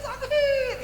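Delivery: high, thin mock scream of pain; small falsetto yelp, imitating agony; retold screaming. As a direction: squeal in pain but softly. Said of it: "saya teriak-teriak".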